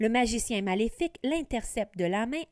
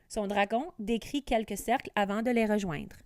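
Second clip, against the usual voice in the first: plain reading voice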